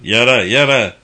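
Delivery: loud, insistent repeated order; shouted command. A narrator's reading. Raiding men order people to the floor, shouting. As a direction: force them down with your voice.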